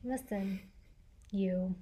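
low, quiet, menacing warning; lightly voiced stern tough guy; deep firm voice. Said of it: "channeling your inner Liam Neeson".

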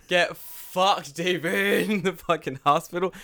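loud, high gleeful teasing; laughing taunt. Real, not acted. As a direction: rib a friend through laughter. Gleefully teasing friend about French check.

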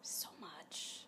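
hushed whisper. Real, not acted. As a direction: breathe it out softly. Whispered aside while watching.

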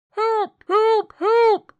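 high, loud, desperate repeated shouts; shouted cry for help. A narrator's reading. A half-smothered voice shouted from overhead, desperate high cry. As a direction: shout for rescue in short high bursts. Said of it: "a half-smothered voice shouted".

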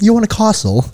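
high mock British sales offer; jokey British accent, pitched well up; British estate agent. Host imagining friend selling houses, exaggerated British. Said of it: "as a British real estate agent".

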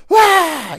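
high mock wail of alarm. Mimicking father-in-law's panic over the news, high yelp. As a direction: squeal it like a panicking newsman. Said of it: "he's almost at a fever pitch".

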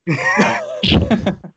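bursts out in high laughter; a real burst of high laughter; laughing. Real, not acted. Group laughing at joke.

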